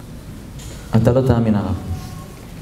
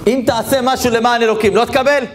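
fervent rhetorical question; 10 semitones higher than usual. Acted. Rabbi challenging his audience: do good for God and not be repaid? fervent, high-pitched. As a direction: lift the whole question up high to rouse them.

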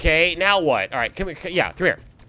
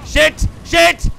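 shouted curse; 11 semitones higher, 5 dB louder than usual. Real, not acted. Dying in a boss fight.

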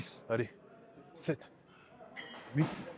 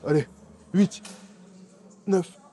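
counting out loud through the strain; grunting the count under a heavy weight; straining reps; 4 semitones higher, 3 dB louder, much slower than usual. Real, not acted. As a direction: push the last reps out, louder and higher.

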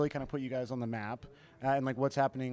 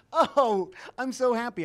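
mock gushing; 8 semitones higher, 4 dB louder, slower than usual. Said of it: "they go".

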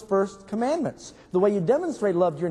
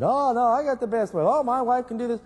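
higher-pitched mock boasting; lightly hammed-up one-upmanship; mock bragging; 6 semitones higher than usual. Drunks bragging about their wives at the saloon, mock boasting.

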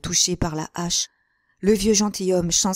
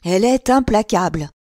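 loud exclamation of dismay; old man's loud outcry; crying out; 2 semitones higher, 6 dB louder than usual. A narrator's reading. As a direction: cry out loudly at someone's hardness. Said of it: "s'écria le vieillard".

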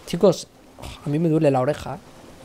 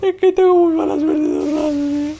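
mock-tearful whine; 10 semitones higher, 4 dB louder than usual.

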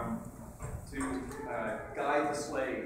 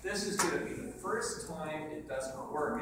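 ordinary delivery

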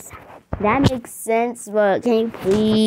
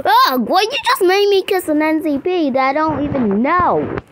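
high, loud yelp and complaint; shriek, then protest how gross it was; loud disgusted whining; outraged protest; 7 semitones higher, 4 dB louder than usual. Pretend. Made to kiss a stranger.

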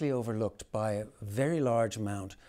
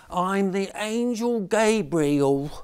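slow, high, louder deadpan schoolboy; flat deadpan boy; 8 semitones higher, 8 dB louder, slower than usual. A narrator's reading. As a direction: recite it flatly like a bored boy. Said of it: "in a flat voice".

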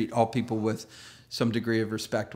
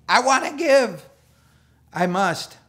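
eager pleading; 7 semitones higher, 6 dB louder than usual. Retold or imitated. Believers pressure Paul.

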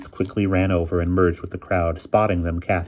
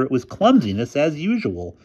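higher-pitched scornful complaint; retort; 7 semitones higher, 4 dB louder than usual. A narrator's reading. Boy blames his friend's clumsiness, scornful retort. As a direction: correct them with scorn, pitched up. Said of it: "retorted Wilbur".

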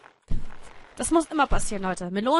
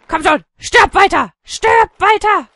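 loud, raised, forceful yells; urging shout; 9 semitones higher, 11 dB louder, slower than usual. Pretend. Player urging the character to die, shouting. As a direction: goad it on loudly.

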